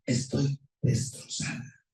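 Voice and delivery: slow, whispered and devastated; heartbroken whisper. Retold or imitated. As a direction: breathe it out like a wife left alone. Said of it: "Sentimentalmente dice".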